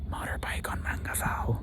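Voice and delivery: breathy whisper, voice all but gone. Real, not acted. Hiding on a dark hill from a biker, whispering. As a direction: whisper so the biker won't hear.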